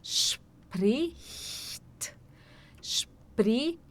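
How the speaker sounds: "Spricht" hushed and slow, "shp-rich-t" with the soft ch. Shown as a demonstration. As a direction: sound out "Spricht" slowly and softly. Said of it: "tentar bem devagar".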